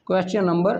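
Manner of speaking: ordinary delivery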